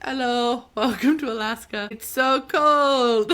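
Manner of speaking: hammed-up guard greeting, stretching the last words; joking guard. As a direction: play the guard's deadpan joke big and loud. Acting out the hot guard's joke.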